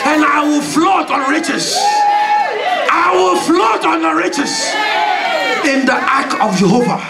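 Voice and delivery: prophesy with full force over the crowd; prophetic declaration. Acted. Preacher declaring poverty drowned to church, shouting.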